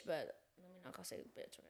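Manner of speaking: quick whispered self-correction; hushed aside. Real, not acted. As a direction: drop to a fast, barely voiced mumble. Self-censoring aside about a swear word.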